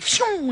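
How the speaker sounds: sharp high whooshing "Shoo!" as she swoops in; whoosh sound. Retold or imitated. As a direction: zip the sound out fast like a superhero landing.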